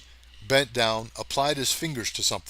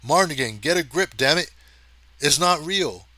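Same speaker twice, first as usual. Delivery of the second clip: loud urgent shout, higher; 4 semitones higher, 6 dB louder than usual. A narrator's reading. Hathaway shouts at Marnigan, pinned under him, urgent shout. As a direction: yell him back to his senses.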